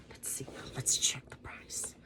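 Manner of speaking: whispered aside to herself; soft whispered check. Real, not acted. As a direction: breathe it under your breath. Whispering to self while checking.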